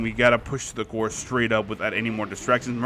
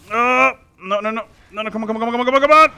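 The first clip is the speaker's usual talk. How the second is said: high, loud desperate pleading; urging the play to land, frantic; psyching myself up; 9 semitones higher, 5 dB louder than usual. Real, not acted. Desperate chase for a kill.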